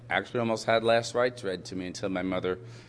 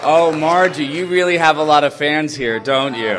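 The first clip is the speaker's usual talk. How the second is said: louder, higher teasing call; calling out teasingly; 5 semitones higher, 11 dB louder than usual. Real, not acted. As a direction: tease her across the room.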